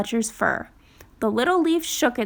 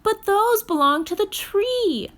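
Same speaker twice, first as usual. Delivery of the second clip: much higher eager little animal voice; Fletcher's voice; 10 semitones higher than usual. Acted. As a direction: promise it earnestly like a small child. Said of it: "said Fletcher".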